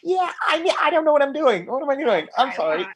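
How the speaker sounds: high, rushed, laughing apology; nervous starstruck babble; flustered fanboy. Retold or imitated. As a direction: gush and stumble over yourself. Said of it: "And I was like".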